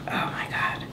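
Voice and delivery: a breathless whispered oh my God. Real, not acted. Patient whispering, overwhelmed by emotion after the adjustment.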